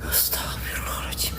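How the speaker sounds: hushed, breathless prayer under the breath; scared whisper in the dark; frightened whisper. Real, not acted. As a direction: whisper it so nothing hears you. Scared in dark, hearing crying.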